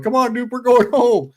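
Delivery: high, loud urgent call to the dog. Retold or imitated. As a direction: shout it up high, hurrying the dog away. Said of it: "I'm like".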